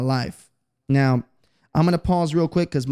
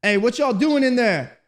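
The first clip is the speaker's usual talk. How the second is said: dad calling through door; 8 semitones higher than usual. Retold or imitated. Dad knocking on door, retold.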